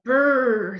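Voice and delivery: high, loud shivery brr; a chilly girl's brr; Annie shivering. Acted. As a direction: shiver it out like a cold little girl. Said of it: "said Annie".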